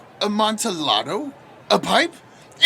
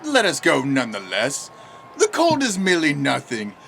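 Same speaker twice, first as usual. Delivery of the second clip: normal talking voice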